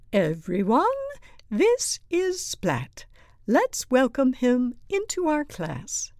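high, projected teacher voice; teacher addressing class. A narrator's reading. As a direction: announce the new pupil to everyone. Teacher presents pupil to class.

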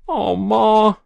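high, loud drawn-out plea; whining protest. A narrator's reading. Boy protesting to his mother, whining. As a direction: wail it in protest. Boy pleads after being scolded.

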